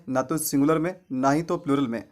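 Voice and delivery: ordinary delivery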